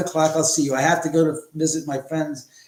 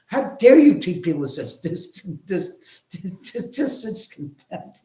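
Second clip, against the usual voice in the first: higher, sputtering and lisping comic outrage; mock indignation; 6 semitones higher, 5 dB louder, slower than usual. Host imagines scolding the rude character, in hammed-up sputtering indignation. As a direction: stammer into a drawn-out, affected finish. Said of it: "the character's like".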